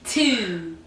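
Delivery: drawn-out Baltimore TWO; mock Baltimore accent. Co-host shows off the hilarious Baltimore accent, mock accent. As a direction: mimic the Baltimore vowel in TWO. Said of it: "Have you heard a Baltimore accent?".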